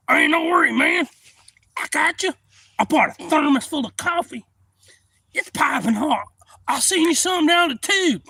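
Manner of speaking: loud high-pitched voice; high shout. Retold or imitated. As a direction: shout it down the creek. Buddy shouting down.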